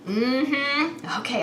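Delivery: usual speaking voice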